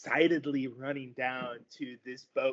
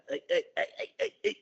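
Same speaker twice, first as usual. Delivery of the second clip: high frog voices calling hey; eager little frogs hailing the boat; chirpy frog hellos; frog folk greeting; 5 dB louder, faster than usual. Acted.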